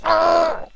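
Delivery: very high yelp of pain; cartoonish pained squeal; mock pained scream. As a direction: squeal like your nose is twisted. Acting the friend's pain.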